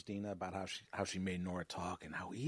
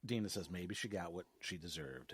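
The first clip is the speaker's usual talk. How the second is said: ordinary talk, nothing marked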